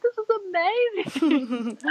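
high, slow, thrilled exclamation; overjoyed mother after delivering the head; ecstatic. Retold or imitated. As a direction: pitch way up, savouring each word. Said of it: "So I was just ecstatic".

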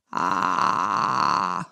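tense creak. Shown as a demonstration. Voice coach showing the wrong, tense creak, pressed fry. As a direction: clamp the throat and grind out a strained creak. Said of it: "Det er feil".

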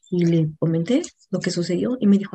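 usual speaking voice

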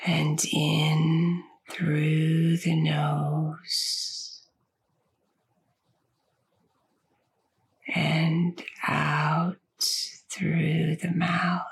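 slow, soothing meditation voice with long pauses; unhurried, stretched words, easing the listener toward sleep; guided sleep meditation. Acted. Host guiding listeners with insomnia into sleep, slow guided meditation.

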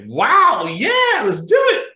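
high, loud eager yes; excited agent. Retold or imitated. Airline agent cheering along with the caller, high and loud. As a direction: leap at the idea with joy. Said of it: "And she's like".

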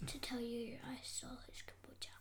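whispered confession. Real, not acted. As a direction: breathe out the secret, no voice. Confessing a secret theft.

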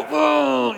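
a high exasperated "ooh"; stressed groan. Retold or imitated. Traveler at the airline counter, high exasperated groan. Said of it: "I'd get all worked up and stressed".

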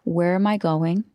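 ordinary talk

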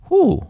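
high-pitched breath of relief; relieved whew. Acted. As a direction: blow off tension in a whew. Relief after reframing the anger.

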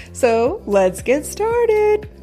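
slow, high sing-song sign-on; drawn-out intro. Acted.

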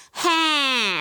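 high whiny "Ha!"; nasal whiny demo note; whiny vocal sound. Shown as a demonstration. Vocal coach demos a whiny placement sound. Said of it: "It's just really whiny".

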